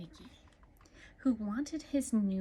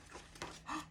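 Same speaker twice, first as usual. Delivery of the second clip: hushed gasp; 8 semitones higher, 11 dB quieter than usual. Acted. Nicky spots lost mitten.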